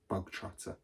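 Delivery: "Bogtrotter" breathed out soft and unvoiced; soft coaxing. A narrator's reading. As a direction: purr his name menacingly. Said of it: "her voice was suddenly softer".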